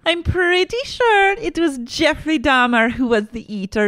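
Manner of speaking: high-pitched mock correcting voice; mock correction. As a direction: put on a high voice to set them straight. Mock know-it-all correction.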